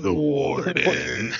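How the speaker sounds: drawn-out, lightly hammed-up echo; mock-ominous. Echoing a friend's nickname for the wife, mock-ominous. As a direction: stretch it out like a mock title announcement.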